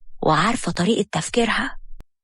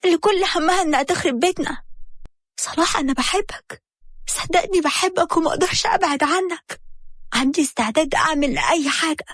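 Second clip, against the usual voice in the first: higher, pleading wife begging him; pleading Habiba; 7 semitones higher than usual. A narrator's reading. Wife begging her husband not to leave, higher and pleading.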